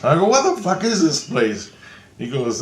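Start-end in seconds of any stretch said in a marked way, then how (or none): 0.3-1.7 high-pitched baffled exclamation; re-enacted amazed question; retold bewilderment. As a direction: act out being stunned by the place. Retells own bewilderment in the dream.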